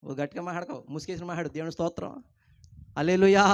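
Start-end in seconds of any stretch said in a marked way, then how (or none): 3.0-3.4 a sudden loud hallelujah call. Real, not acted. Pastor rousing the congregation: loud hallelujah call.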